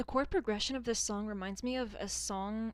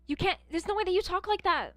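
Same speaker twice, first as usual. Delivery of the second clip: mocking an American accent, much higher and louder; 9 semitones higher, 9 dB louder than usual. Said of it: "a certain American accent". (exaggerated American accent impression)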